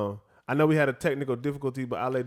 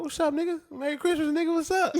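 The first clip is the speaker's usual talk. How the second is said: very high-pitched, excited hello; casual family greeting; 3 dB louder than usual. Acted. Grandson greeting his grandpa, high and familiar. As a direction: holler a holiday greeting up high. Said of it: "that's how we talk to each other".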